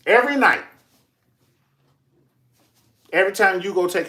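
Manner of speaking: loud, high cry of every night; loud high exclaim. Acted. Telling of the spinning grandmother, a loud high cry. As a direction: shout it out in disbelief.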